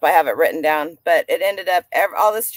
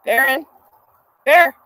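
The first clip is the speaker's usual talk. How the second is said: calling the dog; 4 semitones higher than usual. Real, not acted. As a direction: call out to the dog across the room.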